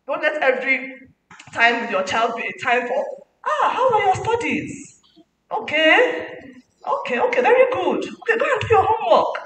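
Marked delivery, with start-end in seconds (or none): 3.5-9.4 high, brisk, perfunctory parent's voice; dismissive parent. Retold or imitated. Parent briefly questioning child about studies, high and brisk. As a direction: tick the box and send them off.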